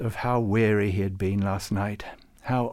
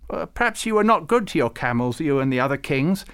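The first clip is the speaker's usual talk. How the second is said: higher, louder indignant retort; 5 semitones higher, 5 dB louder than usual. A narrator's reading. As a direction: bristle at the insult. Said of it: "said Farouk indignantly".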